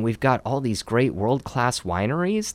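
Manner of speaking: usual speaking voice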